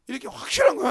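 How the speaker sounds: usual speaking voice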